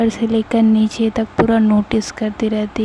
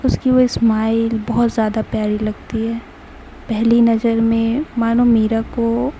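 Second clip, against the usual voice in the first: ordinary delivery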